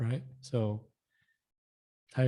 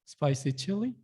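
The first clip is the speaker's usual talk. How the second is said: normal talking voice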